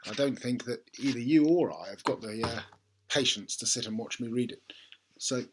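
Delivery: ordinary delivery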